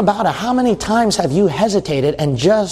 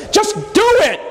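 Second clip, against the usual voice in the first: high-pitched exasperated outburst; explosive, fed-up command; blowing up; 14 semitones higher than usual. Acted. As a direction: act out God finally losing patience, pitch leaping up. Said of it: "finally the Lord blew up".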